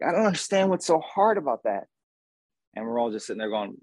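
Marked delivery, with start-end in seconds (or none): 0.0-1.8 higher, mock-frustrated quote; mock exasperated friend. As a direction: ham up her puzzled complaint. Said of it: "It's like".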